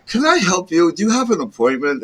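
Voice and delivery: high, laughing receptionist quote; laughing secretary voice. Retold or imitated. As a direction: quote her through laughter.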